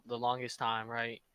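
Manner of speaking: usual speaking voice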